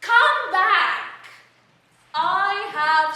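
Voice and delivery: loud call to come back. Acted. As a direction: shout after her. Caterpillar calls out after Alice.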